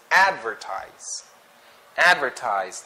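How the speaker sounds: "advertise" stressed on AD; stress on first syllable. Shown as a demonstration. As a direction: punch the opening AD of "advertise". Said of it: "the stress is in the first syllable".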